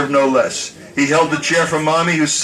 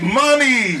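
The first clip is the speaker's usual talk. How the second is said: high, laughing call to mother; joyful cry; 7 semitones higher than usual. Retold or imitated. Son hugging his mother, high joyful cry. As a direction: cry out with delight.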